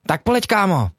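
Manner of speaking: high-pitched cartoon-hero call; animated character's high, punchy shout-out; Škyťák. Shown as a demonstration. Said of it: "něco říct jako Škyťák".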